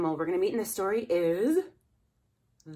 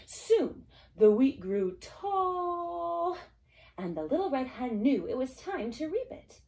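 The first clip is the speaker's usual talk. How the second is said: put-on reading to a toddler; 7 semitones higher, slower than usual. A narrator's reading. Story narration.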